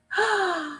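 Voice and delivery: sharp startled gasp. A narrator's reading. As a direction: draw in a shocked breath. Storyteller gasps at the fall.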